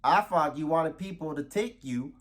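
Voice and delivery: high, hammed-up Texan whoop and drawl; over-the-top Texas host voice; Texas accent. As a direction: yeehaw it up like a cartoon Texan. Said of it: "talk in your normal Texas accent".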